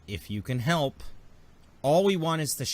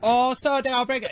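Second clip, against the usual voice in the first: high, loud hammed-up Nemodian accent; a squeaky, overdone Nemodian alien voice; Nemodian accent, mock; 12 semitones higher, 7 dB louder than usual. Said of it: "butchering this accent".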